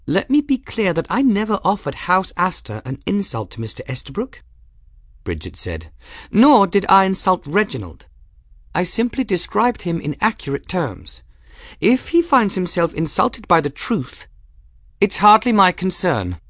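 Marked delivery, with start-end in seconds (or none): 0.1-4.4 put-on high-pitched, composed young woman; Bridget, firm and formal. A narrator's reading. Young woman answering the master of arms, firm. 6.3-16.4 put-on high-pitched, composed young woman; Bridget, firm and formal. A narrator's reading. Young woman answering the master of arms, firm.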